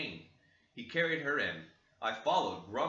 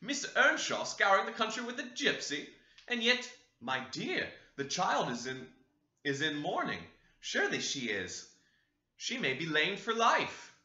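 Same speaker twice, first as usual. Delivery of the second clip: shocked lady; 6 semitones higher, 3 dB louder than usual. Acted. The dame exclaims.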